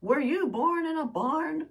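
loud, high, slow parental scold; mock parent yelling down the hall. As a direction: call out like an exasperated mom. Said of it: "you hear a voice call out".

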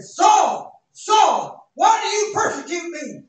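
loud, high shout of his name; the Lord calling out to Saul in a shout; booming divine call. Acted. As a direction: call down from heaven. The Lord calls Saul from the light.

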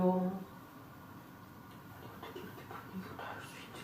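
slow, awed whisper. Real, not acted. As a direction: hush it, slowly. Awed by the eerie room.

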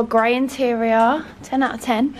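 normal talking voice

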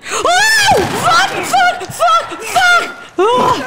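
real panic at the attack; panicked swearing. Real, not acted. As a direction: blurt each one in fright. Attacked by infected.

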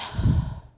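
a breathy tired sigh; whispered sigh. Real, not acted. Gamer with a sore stomach sighing wearily. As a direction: let out a weary sigh.

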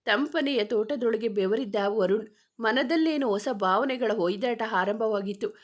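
usual speaking voice

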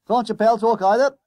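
loud, high booming conductor voice. A narrator's reading. Conductor questioning the silent friend, booming. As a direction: boom it at the boy. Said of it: "the man boomed at Derbyshire".

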